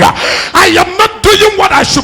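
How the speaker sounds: high, loud preaching roar as Paul's inner cry; shouted preaching cry. Acted. Preacher voicing Paul's restless spirit to the church, shouting. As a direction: bellow it out, full force, burning with frustration. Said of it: "Somebody said".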